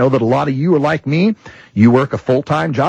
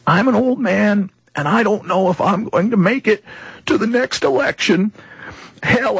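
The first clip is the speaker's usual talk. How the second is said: higher, shaky-voiced old veteran, lightly voiced; a hint of an old man near tears; shaken old man; 9 semitones higher than usual. Old veteran telling the host his fears, lightly voiced. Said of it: "His, his voice is breaking".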